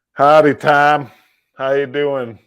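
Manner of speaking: loud, high friendly howdy; cheerful howdy. Real, not acted. Streamer greeting his old friend, loud and cheerful. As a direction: holler a big hello.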